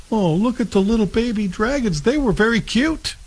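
put-on high, cutesy baby-talk gush; a light mock gush over tiny dragons; mock cooing. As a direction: coo over the baby dragons like a smitten fan.

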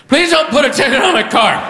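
high, strained begging yell; desperate plea. Acted. Comic trying to talk out of a ticket, high pleading. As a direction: blurt out a panicked plea. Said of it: "Here's my attempt to talk my way out".

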